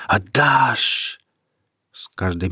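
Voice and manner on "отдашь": voiced, rising water cry. The flood demanding Peter's heart, voiced cry. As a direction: call it out over the torrent.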